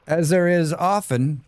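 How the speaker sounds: usual speaking voice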